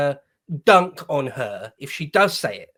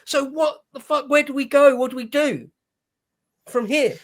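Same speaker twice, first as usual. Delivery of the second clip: higher-pitched pleading outrage; exasperated outburst; 7 semitones higher than usual. Real, not acted. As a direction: throw up your hands in real disbelief. Outraged reaction to mayor's answer.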